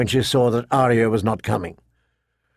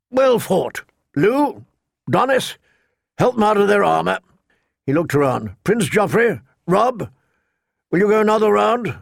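put-on raised voice of a gruff old master-at-arms; old knight voice; 7 semitones higher than usual. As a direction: command the yard like an old knight.

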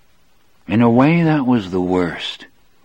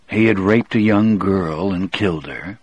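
usual speaking voice

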